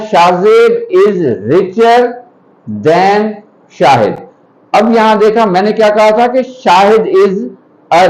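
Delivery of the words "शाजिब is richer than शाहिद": slow dictation. Shown as a demonstration. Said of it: "पहला जुमला है".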